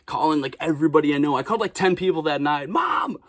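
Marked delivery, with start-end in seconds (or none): 2.7-3.2 much higher, breathless news; thrilled son phoning home; excited call to mom. Retold or imitated. Calling mom after the shoot.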